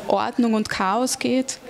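ordinary talk

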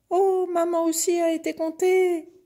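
very high, loud calf exclamation; calf crying out. A narrator's reading. Calf seeing mother counted too, high alarmed cry. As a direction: cry out in a little calf's high voice. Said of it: "s'écria le veau".

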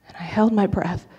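usual speaking voice

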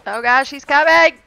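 panicked. Real, not acted. Gamer warning team an enemy is coming, panicked. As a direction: call out in a sudden panic.

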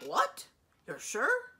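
shocked. A narrator's reading. Dragon hearing a wizard came near, high shocked disbelief. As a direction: jump up in alarmed disbelief.

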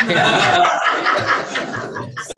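breathy chuckle; own laugh. Real, not acted. Laughing at himself about the socks, breathy chuckle.

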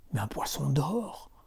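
slow, hushed whisper; confiding whisper. Acted. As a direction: lean in and whisper the secret. Fisherman confides the marvel to wife.

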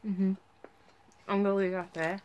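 talking around chewy candy; chewing while talking. Real, not acted. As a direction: mouth partly full. Seen 0: She bit the candy at -7 and still chews during "mm-hmm.

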